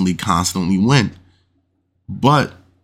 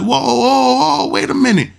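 much higher, louder whoa of alarm; imitating shocked player; 3 dB louder than usual. Retelling the gambler spotting the dealer's trick, shocked mock cry. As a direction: throw up a startled whoa, wait. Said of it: "And the dude's like".

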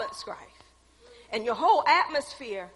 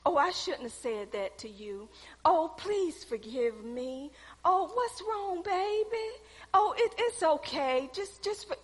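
mock pity coddling; 7 dB quieter, slower than usual. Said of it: "We want somebody to say".

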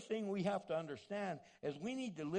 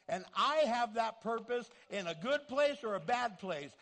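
louder, driving sermon climax; full-force preaching; 3 semitones higher, 7 dB louder than usual. Acted. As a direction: proclaim it to the whole room.

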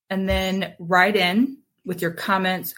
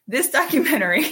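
laughing through the words; high, giggly start; breaking character giggling; 10 semitones higher, faster than usual. Real, not acted. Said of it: "I can't do it with a straight face".

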